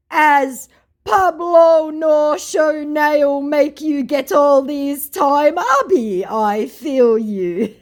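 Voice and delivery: slow, high, loud Nigerian Pidgin voice; a hammed-up Nigerian Pidgin accent; mock Nigerian Pidgin. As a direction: drag out the Nigerian Pidgin like the scammer. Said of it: "What the fuck that meant".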